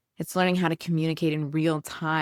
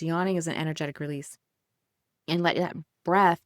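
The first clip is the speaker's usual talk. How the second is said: ordinary delivery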